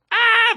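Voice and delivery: loud, very high yelled "ah"; imitated frantic noise. Man behind the camera signalling the host, high mock yell. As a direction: imitate a crew man's shrill urgent signal. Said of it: "making noises at me".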